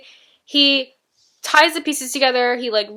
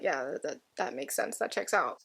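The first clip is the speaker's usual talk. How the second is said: quiet mock reply; 4 semitones lower, 13 dB quieter than usual. Imagined girlfriend's offhand reply.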